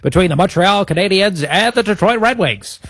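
high, theatrical vintage radio announcer; old-timey radio voice. Shown as a demonstration. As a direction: announce it like a 1950s broadcaster. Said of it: "do the next bit in the old-timey radio voice".

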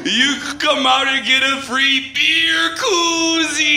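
hammed-up radio ad read; radio promo. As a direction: sell the store event like a DJ.

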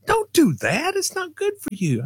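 high imitated mother warning; mom scolding.

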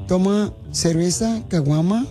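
ordinary delivery

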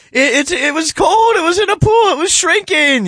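hammed-up high-pitched American comic; high-pitched Seinfeld impersonation. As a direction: loudly squeal it like a panicked sitcom character. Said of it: "real high-pitched voice like this".

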